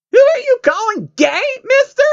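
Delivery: very high, loud, whiny cry; very high tearful squeal of outrage; offended shriek. Acted. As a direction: screech it out, deeply offended. Pony insulted, shrieking back.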